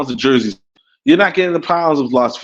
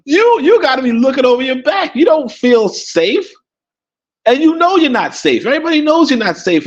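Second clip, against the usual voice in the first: raised emphatic; 10 semitones higher than usual. Real, not acted. Stressing danger in America.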